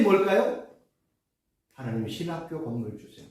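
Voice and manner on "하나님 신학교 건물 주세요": low, soft, simple prayer; quiet sample prayer. Shown as a demonstration. Preacher to seminary students, modeling a soft low prayer. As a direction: murmur the short prayer as a model. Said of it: "이게 핵심이란 말이에요".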